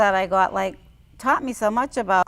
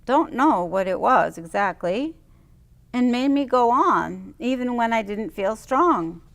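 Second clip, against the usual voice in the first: normal talking voice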